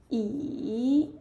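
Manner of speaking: drawn-out dipping 椅; third tone. Shown as a demonstration. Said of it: "which is the third tone".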